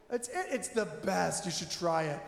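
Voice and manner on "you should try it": ordinary delivery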